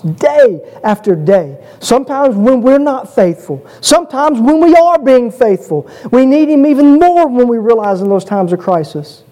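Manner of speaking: high-pitched, driving sermon voice; fervent preacher in full flow; impassioned preaching. Real, not acted. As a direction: drive the point home to the congregation. Preaches with rising force.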